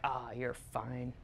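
low, soft, slow cop voice; easygoing cop. Acted. Cops let off an Irish passport holder, low indulgent drawl. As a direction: wave it away quietly as a cop. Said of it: "and they're like".

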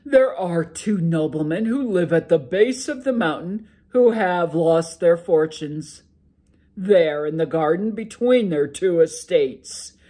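a sleepy giant ogre grumbling to his wife; loud ogre brushing off his wife's worry. Acted. Ogre explains remedy.

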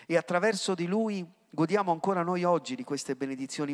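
ordinary talk, nothing marked

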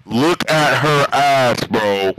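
loud exclamation. Real, not acted. Gamer to a friend, loud and drawn-out. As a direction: blast it out, drawing each word out. Same line, then loud take.

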